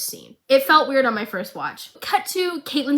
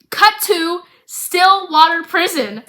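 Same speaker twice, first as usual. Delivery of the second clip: higher, louder and slow, like a trailer voice; dramatic announcer; 7 semitones higher, 7 dB louder, slower than usual. Acted.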